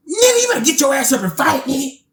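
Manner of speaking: high-pitched imitated command; urgent mock order. Retold friend orders him to fight.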